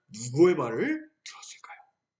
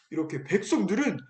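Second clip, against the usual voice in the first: ordinary talk, nothing marked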